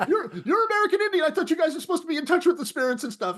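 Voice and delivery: babbling through laughter while being dragged; laughing panicked plea. Retold or imitated.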